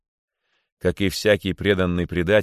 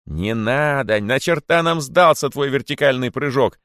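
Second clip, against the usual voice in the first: impatient heckle, rising louder; interrupting; 5 semitones higher, 4 dB louder than usual. A narrator's reading. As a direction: cut the speaker off. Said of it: "стали перебивать её кролики". (нетерпеливо перебивая)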